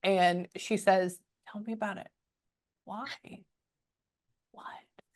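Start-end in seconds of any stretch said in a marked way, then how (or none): 1.5-5.0 very soft, to an unseen spirit; barely audible private questions; murmured questioning. Real, not acted. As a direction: ask under your breath, with pauses.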